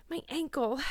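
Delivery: high, pained gasp. A narrator's reading. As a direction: gasp it out through pain. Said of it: "gasped Anne".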